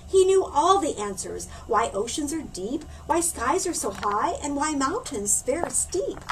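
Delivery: ordinary delivery, nothing marked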